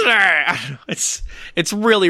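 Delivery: ordinary talk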